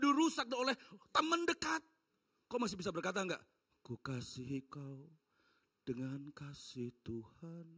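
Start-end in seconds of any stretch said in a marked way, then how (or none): 3.9-7.7 slow, much softer, forcing the words; strained soft hymn line. Acted. Preacher imagines saying it to a betrayer, soft and strained. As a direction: say it as if through hurt. Said while hurt.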